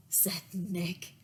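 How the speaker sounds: usual speaking voice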